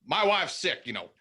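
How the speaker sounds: high Southern twang; Southern accent. Acted. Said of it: "that's the go-to".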